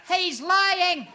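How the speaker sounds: high, loud shrill-free cry of protest; mother's yell. Retold or imitated. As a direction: shout from the crowd. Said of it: "my mother yelled out".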